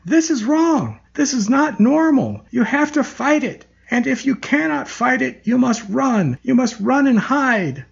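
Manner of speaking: high, defiant yelling. Acted. Elizabeth, dragged away, yells a defiant warning to patients. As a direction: hurl the warning back as she's dragged off. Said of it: "she defiantly yelled".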